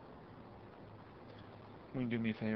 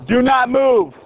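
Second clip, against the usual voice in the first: shouted command; 7 semitones higher, much slower than usual. Real, not acted. Officer holds suspect at gunpoint.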